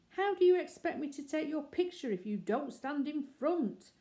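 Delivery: agitated. Acted. Photographer scolding the bear, mildly agitated. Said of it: "he asked in an agitated voice".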